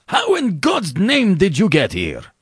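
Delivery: high-pitched, astonished scream. A narrator's reading. General confronts the man who escaped him, screaming. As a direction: demand it in a high, shocked outcry. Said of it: "screamed the general".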